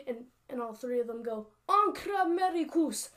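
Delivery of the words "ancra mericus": very loud, high rallying shout; shouted war cry. Acted. Three Scottish warriors attack from the rocks, shouted war cry. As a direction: belt it out for three warriors at once.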